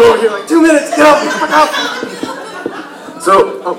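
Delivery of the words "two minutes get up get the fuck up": raised, high shout; mock urgent call. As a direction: holler it higher, hurrying someone along. Calling out a time warning.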